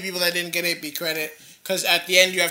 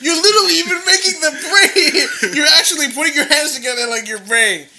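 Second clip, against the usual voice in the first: laughing; 10 semitones higher, 9 dB louder than usual. Real, not acted. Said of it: "laughing at friend's act".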